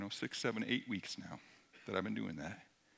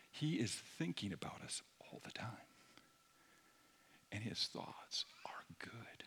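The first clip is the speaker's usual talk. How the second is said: whispered, reverent sermon lines; hushed awe; 3 semitones lower, 10 dB quieter than usual. Acted. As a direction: breathe it out in wonder. Intimate whispered preaching.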